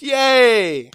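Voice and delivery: mock cheer, high and loud; sarcastic yay. Real, not acted. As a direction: fake cheer about bad news. Sarcastic cheer about doctor.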